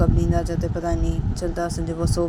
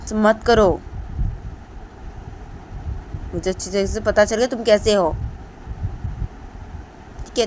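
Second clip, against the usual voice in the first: a touch higher, louder, scolding warning; stern scolding; 4 semitones higher, 7 dB louder than usual. Acted. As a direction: warn him off firmly. Deepanta keeps scolding.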